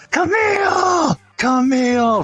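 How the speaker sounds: high, drawn-out yell of a name; calling out. Retold or imitated. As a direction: holler it across a crowded bar. Calling for Camille from the bar.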